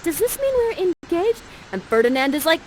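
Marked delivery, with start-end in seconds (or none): 0.0-1.4 put-on high, gushing young maiden; high girlish Miranda. Miranda proposing to Ferdinand, in a high gushing voice. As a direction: propose sweetly. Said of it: "Miranda's like".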